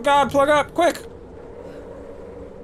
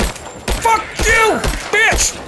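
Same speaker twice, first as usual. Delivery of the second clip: furious shouting; 12 semitones higher, much slower than usual. Real, not acted. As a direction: yell at the beast attacking you. Fighting off a cougar.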